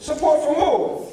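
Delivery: high, drawn-out disbelief; incredulous question. Retold or imitated. Son doubting his father's talk of support, incredulous. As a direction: stretch it out, voice jumping up.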